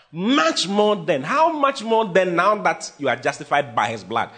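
MUCH stretched out high and slow; preaching emphasis, slow and rising; slow emphatic build. Acted.

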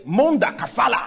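mock tongues exclaimed; tongues like an exclamation. Shown as a demonstration. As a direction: throw it out like an exclamation. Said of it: "That's exclamation sign".

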